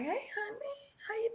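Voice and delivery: soft whispered sweet greeting. Retold or imitated. Wife greeting her husband at the door, hushed and sweet. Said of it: "I was like".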